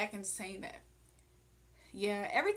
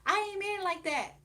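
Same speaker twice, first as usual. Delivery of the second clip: mock excuse; 11 semitones higher, 4 dB louder than usual. Imitating a pump faker hater.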